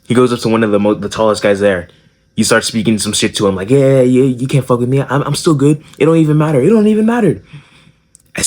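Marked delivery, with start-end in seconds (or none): 3.7-5.8 a hint of a cocky kid; lightly voiced cocky boast; small kid talking trash to the tallest guy. 6.0-6.6 lightly mocking cocky kid. Kid talking trash. 6.6-7.4 high, strained mock bravado; the kid repeating it higher and shriller to a tall jock; squeaky cocky kid.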